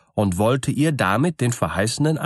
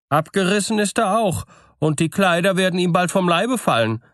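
lightly voiced sly farmer telling tall tales, higher; farmer's voice; 7 semitones higher than usual. A narrator's reading. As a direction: spin the lie with a straight face. Farmer spins his tale. (schlauer Bauer, der flunkert, höher)

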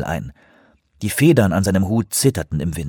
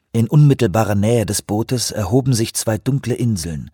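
plain reading voice

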